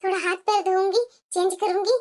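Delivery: low, soft aside; talking to herself. Acted. Wife planning to herself on getting home, hushed aside.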